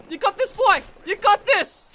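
high, loud encouragement; urgent cheering. Real, not acted. Player rooting for teammate, urgent cheering. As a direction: cheer your teammate on at full voice.

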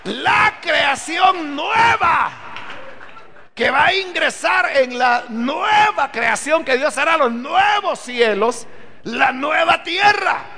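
slow, ringing pulpit proclamation, every phrase hammered home; preaching at full force. Acted. Preacher to his congregation about the new creation, full-force preaching.

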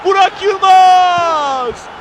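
slow, drawn-out name scream; screaming football commentator. Real, not acted. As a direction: stretch the name at full volume. Goal call in live commentary.

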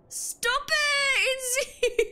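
very high, drawn-out, playful cry; squealed protest. Real, not acted. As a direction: wail at a teasing friend to quit it. Laughing protest at teasing.

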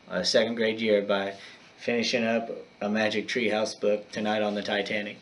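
ordinary delivery, nothing marked

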